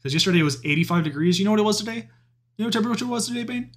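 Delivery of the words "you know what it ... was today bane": exasperated. Real, not acted. Ranting about weather, high voice.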